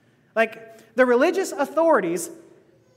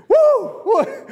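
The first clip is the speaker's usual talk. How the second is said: excited whoop; 12 semitones higher, 8 dB louder than usual. Real, not acted. Awed reaction to Jesus' reply.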